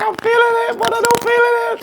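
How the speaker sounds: mock girl voice. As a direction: squeak it out like a girly parody. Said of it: "She like".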